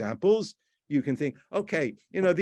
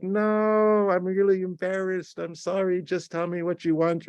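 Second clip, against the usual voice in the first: exaggerated embarrassment; 6 semitones higher than usual. Boyfriend apologising over the Christmas present, exaggeratedly embarrassed. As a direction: wail the no, then plead in a raised voice. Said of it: "This kind of exaggerated expression".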